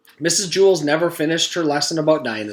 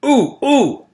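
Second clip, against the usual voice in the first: put-on high strained "ooh, ooh" like a kid dying to be called on; eager straining groan; 13 semitones higher, 6 dB louder than usual. A narrator's reading. Mac straining to be called on, high eager groan. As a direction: squeal it out, hand stretched high. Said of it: "Mac groaned as he stretched".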